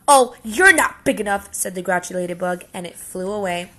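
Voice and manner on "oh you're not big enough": loud, high, dismissive sneer; scornful brush-off. Acted. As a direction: wave him off to save face. Grouchy ladybug backs off.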